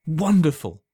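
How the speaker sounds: high, loud cry of delight. A narrator's reading. Alan to the old man, crying out in delight. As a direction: burst out with joy. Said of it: "cried Alan".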